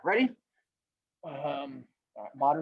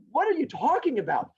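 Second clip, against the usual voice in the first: acted indignation; 8 semitones higher than usual. Turtle answers rabbit's challenge.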